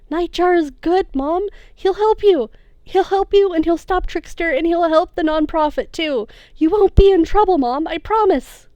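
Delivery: high, loud earnest pleading; pleading son. Acted. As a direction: promise her desperately.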